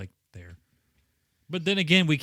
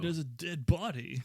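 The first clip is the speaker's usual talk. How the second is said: surfer voice; 4 semitones higher, 5 dB quieter than usual. Retold or imitated. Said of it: "he sounds like a fucking surfer".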